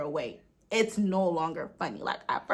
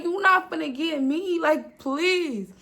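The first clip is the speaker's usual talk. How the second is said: panicked plea; 8 semitones higher, 8 dB louder, slower than usual. Retold or imitated. Scared kid pleading with jinn.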